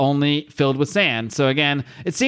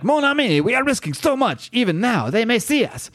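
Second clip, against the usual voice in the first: much higher, hammed-up French accent; 9 semitones higher than usual. Host voicing Frenchie warning Moon Knight, in a high French accent. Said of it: "there, the French is back".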